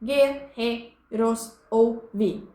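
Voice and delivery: very slow "guerreiros ouvi", a gap after each syllable; "guerreiros ouvi" chopped evenly into syllables. Shown as a demonstration. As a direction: split "guerreiros ouvi" into five separate beats. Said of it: "distribuir as sílabas gramaticais".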